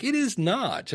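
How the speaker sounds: high, playful mock disbelief. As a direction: imitate someone who can't believe who's calling. Caller disbelieves it's Dave.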